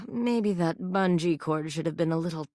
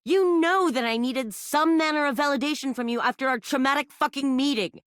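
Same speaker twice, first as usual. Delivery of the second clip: higher, wounded and accusing; pitched-up wounded complaint; hurt outburst; 8 semitones higher than usual. Acted. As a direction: throw the hurt back at them. Hurt character lashing out at player.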